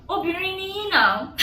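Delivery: high, loud mock of the women in Yoruba. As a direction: sneer it like the gossiping women. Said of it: "started saying".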